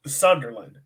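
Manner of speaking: normal talking voice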